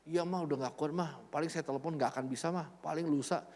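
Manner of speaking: soft, low, drained pleading; barely able to answer, too ill; mild imitation of a feverish son; weak and sick.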